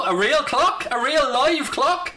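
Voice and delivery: a touch of sarcastic wonder, rising high and slow; mock excitement. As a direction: sell the clock like a big treat. Mocking viewer who wanted timer.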